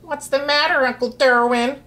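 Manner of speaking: put-on high, small child's call; small boy voice. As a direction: pipe it up like a little boy. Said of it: "A small voice".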